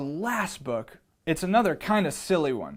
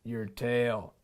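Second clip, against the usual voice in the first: lion voice; 5 semitones lower, 4 dB quieter than usual. Acted. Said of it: "said the lion".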